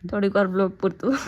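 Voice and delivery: usual speaking voice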